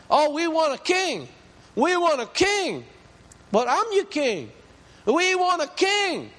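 imitating the people begging for a king; whining people.

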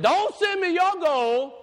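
high, slow prophet's cry; forceful refusal. Acted. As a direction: refuse it at full preaching pitch. John's answer to the TV preacher.

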